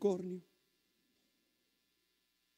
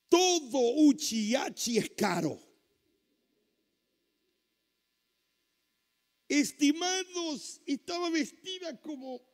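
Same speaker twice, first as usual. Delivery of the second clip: loud, very high, stretched-out exclamations; exclamatory full-force preaching; 13 semitones higher, 8 dB louder, slower than usual. Real, not acted. Preacher marvelling at the expensive outfit, high shouted exclamation. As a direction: shout in high-pitched amazement, drawing words out. Exclaims over the lady's finery.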